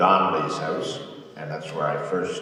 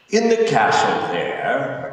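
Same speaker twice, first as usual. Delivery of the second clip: slightly slow, raised, louder order; commanding vizier; 7 semitones higher, 9 dB louder, much slower than usual. Acted. Vizier shows warden the fortress, commanding. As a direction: point it out with loud authority. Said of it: "Akasi said".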